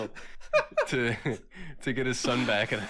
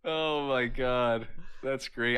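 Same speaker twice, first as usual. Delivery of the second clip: slow amazed drawl; much slower than usual. Retold or imitated. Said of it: "he's like".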